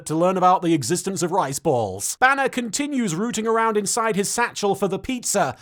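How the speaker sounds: usual speaking voice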